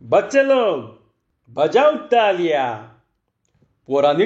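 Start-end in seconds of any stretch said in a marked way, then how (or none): 0.0-2.9 mildly raised, drawn-out showman's call. A narrator's reading. Magician to the children at his show, calling out. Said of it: "मग तो ओरडला".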